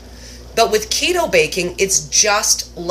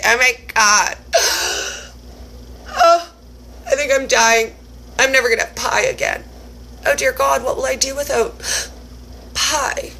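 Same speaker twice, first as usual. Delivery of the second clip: mock despair; 6 semitones higher than usual. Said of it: "And I was like".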